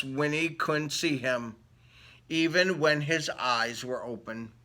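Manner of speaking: lively read-aloud storybook voice; story narration. Acted. Reading a picture book to children, lively storytelling voice. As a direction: read it like bedtime story time.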